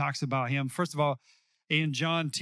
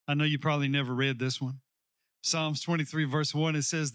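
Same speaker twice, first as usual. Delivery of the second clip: usual speaking voice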